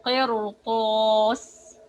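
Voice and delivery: slow, the ra in قرطاس full-mouthed and heavy. Shown as a demonstration. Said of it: "The second word is".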